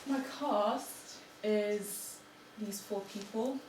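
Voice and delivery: ordinary delivery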